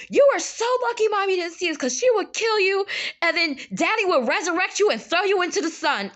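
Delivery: high-pitched, animated telling-off; imitating a kid sister laying into him; retold sisterly scolding. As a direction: scold the brother with mock drama. Imitates sister scolding her brother.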